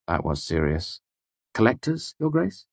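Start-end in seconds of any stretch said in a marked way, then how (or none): 1.5-2.7 Collectors leaned on hard, rising into a question; stressing the plural. A narrator's reading. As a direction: hit the s of Collectors, pitch up on Grace. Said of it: "stressing the plural".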